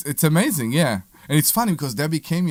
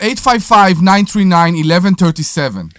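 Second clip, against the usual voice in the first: yelled phone number; 3 semitones higher, 6 dB louder than usual. Real, not acted. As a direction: belt the number into the mic. Repeats number loudly after being teased.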